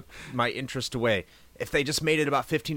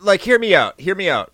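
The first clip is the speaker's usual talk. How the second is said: louder, higher insistent plea; louder pleading insistence; 7 semitones higher, 10 dB louder than usual. Real, not acted. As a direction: demand a fair hearing. Defending an opinion.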